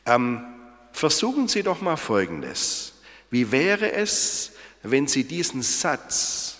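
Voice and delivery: ordinary talk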